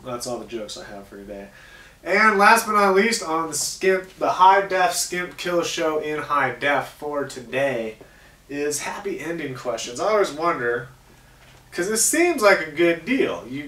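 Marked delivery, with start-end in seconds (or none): 2.0-10.0 announcer voice. Acted. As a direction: hype up the final segment like a host. Show sign-off segment announcement.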